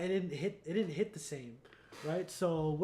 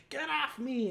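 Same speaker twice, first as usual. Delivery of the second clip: high-pitched mock yell; Vulture's mock yell; 9 semitones higher, 4 dB louder than usual. Vulture to Spider-Man hanging off him, mock yell. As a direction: cry out as if shaking someone off.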